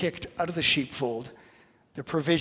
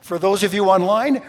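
normal talking voice

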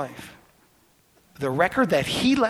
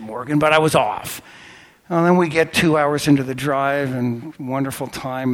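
ordinary delivery

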